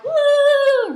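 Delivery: very high, loud mock woo; hammed-up gleeful whoop; imitated excited whoop. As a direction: whoop like a guy running into the sea. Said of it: "He's like".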